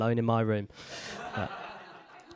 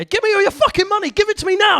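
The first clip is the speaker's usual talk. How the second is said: very high, loud threatening demand; mugger's shout; 10 dB louder than usual. Acted. Mugger demanding all your money, acted high-pitched shout. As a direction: yell it as a frantic mugger.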